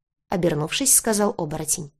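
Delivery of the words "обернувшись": ordinary delivery, nothing marked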